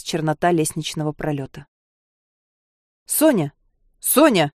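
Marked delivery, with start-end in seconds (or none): 3.1-4.5 alarmed call, louder second time. A narrator's reading. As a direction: call her name in alarm. Calling out to a fainting girl.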